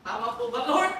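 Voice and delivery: normal talking voice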